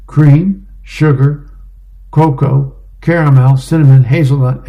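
gleeful order reeled off; eager order. Acted. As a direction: rattle off every topping with relish. Sarge reels off coffee extras.

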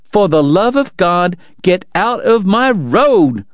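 slow, high-pitched exasperated shout. A narrator's reading. Doc rounding on Kate in his way, shouting. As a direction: raise the pitch and stretch out each word.

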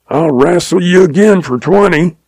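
mild shout. A narrator's reading. Aggrieved man challenges the lion to a rematch, mildly shouted. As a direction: shout a sore loser's challenge without full volume. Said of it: "shook his fist at the lion and shouted".

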